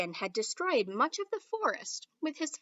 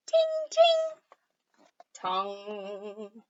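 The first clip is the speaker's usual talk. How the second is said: chimes; 2 semitones lower, much slower than usual. Acted. Bell chimes.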